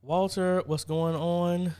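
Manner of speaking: excited greeting. Real, not acted. Greeting a newcomer to stream.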